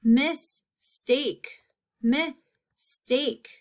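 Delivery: mistake with a long gap in the middle; split into syllables. Shown as a demonstration. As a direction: pause clearly between mis and take in mistake. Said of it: "Second syllable".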